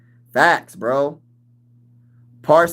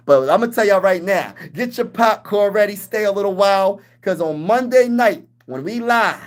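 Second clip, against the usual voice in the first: hype; 6 semitones higher than usual. Real, not acted. Hyping up Monday night game.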